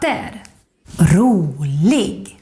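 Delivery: "katter", "rolig" each in two peaks, up, down, up; drawn-out double-peak "katter" and "rolig"; grave accent. Shown as a demonstration. Teacher demonstrating grave accent, "katter, rolig" in two peaks. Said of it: "Like you can see in these words".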